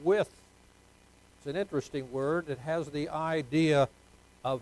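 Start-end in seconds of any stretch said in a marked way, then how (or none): 0.0-0.3 "with" set apart, rising; single out "with" as underlined; stress on with. Shown as a demonstration. Pastor has the congregation underline the word with. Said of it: "Underline the word".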